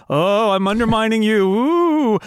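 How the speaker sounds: hammed-up ghostly taunt, rising high on the ooh; spooky mock villain. Mocking the First's empty menace, spooky taunt. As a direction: tease the shapeless villain like a cartoon ghost.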